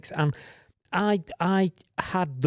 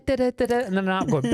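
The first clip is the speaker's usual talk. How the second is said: ordinary talk, nothing marked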